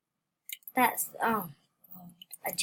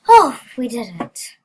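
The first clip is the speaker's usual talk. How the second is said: normal talking voice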